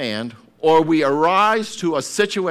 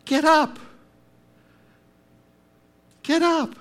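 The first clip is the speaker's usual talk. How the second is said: encouraging call. Retold or imitated. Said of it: "an encourager says".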